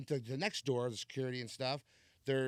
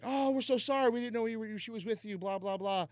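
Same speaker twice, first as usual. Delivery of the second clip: high-pitched mock gushing apology; imitating tipsy apologizers; 9 semitones higher, 4 dB louder than usual. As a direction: imitate tipsy dudes apologizing in a much higher voice. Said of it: "they were a little tipsy".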